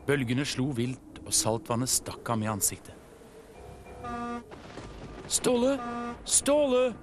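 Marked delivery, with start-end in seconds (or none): 5.3-6.9 very high calls through a storm; urgent high-pitched shouted name; shouting. A narrator's reading. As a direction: call out searching for a missing friend. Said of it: "ropte han".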